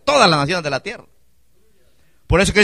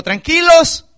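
high, loud, ringing call; Moses reassuring loudly; 11 semitones higher, 7 dB louder, slower than usual. Retold or imitated. Preacher quoting Moses to his people, loud high call. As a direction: call it out over a whole crowd. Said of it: "Pero Moisés dijo".

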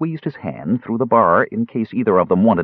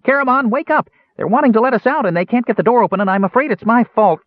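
very high, urgent Kender yell; 5 dB louder, faster than usual. A narrator's reading. Kender waking his friend in the cell, yelling. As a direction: call out excitedly to a sleeper. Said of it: "they heard him yelling".

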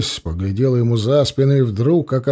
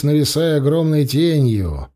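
plain reading voice

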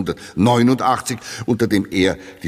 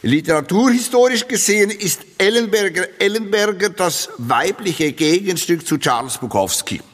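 higher, gushing critic's praise; mock enthusiastic quote; 7 semitones higher than usual. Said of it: "ich zitiere".

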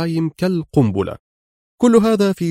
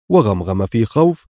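ordinary delivery, nothing marked

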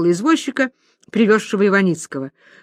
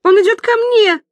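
high, loud terrified cry; frightened; 12 semitones higher, 6 dB louder than usual. Acted. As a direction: scream for help in fear. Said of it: "Аглая испуганно".

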